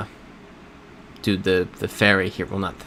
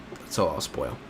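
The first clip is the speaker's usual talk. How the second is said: a quiet, lower teasing echo of the line; low hushed repeat; 6 semitones lower, 10 dB quieter than usual. Shown as a demonstration. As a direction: drop the voice low and soft for the repeat. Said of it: "And that's all I'll spoil".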